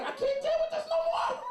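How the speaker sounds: very high screeching outburst; exasperated mom. Retold or imitated. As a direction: screech like a mother at her limit. Imitating exasperated mother's outburst.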